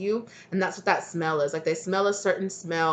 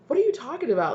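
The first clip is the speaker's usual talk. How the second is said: much higher, mock-baffled question; incredulous; 8 semitones higher, faster than usual. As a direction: act out puzzled disbelief. Voicing the listener's baffled reply.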